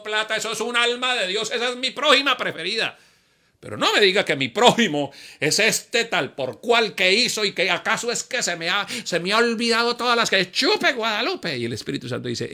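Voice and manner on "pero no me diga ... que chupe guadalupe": indignant protest. Acted. Protesting to God.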